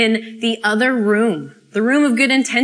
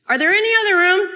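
exasperated plea; 10 semitones higher, 5 dB louder, much faster than usual. Acted. Pleading for another option.

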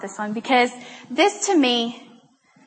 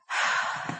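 long audible exhale; resigned sigh; 8 dB quieter than usual. Retold or imitated. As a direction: let out a breath before conceding. Softening before conceding.

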